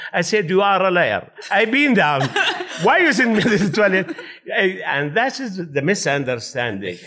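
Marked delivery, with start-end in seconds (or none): 0.5-4.1 retold shouting. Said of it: "So I start shouting more".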